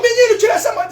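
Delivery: high, loud scolding yell; parent shout. Retold or imitated. Father yelling at son with a coin, loud yell. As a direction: bark it at the child.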